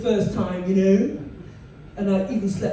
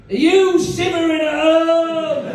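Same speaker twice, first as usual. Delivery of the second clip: slow, higher and louder ad voice; voiceover ad read; 8 semitones higher, 7 dB louder, slower than usual. Shown as a demonstration. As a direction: sell it like a TV advert. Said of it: "I did one for British Gas".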